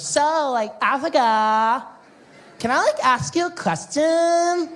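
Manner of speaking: put-on high, slow ditzy girl voice. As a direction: play the airhead beach girl, drawn out. Imitating the ditzy surfer girl.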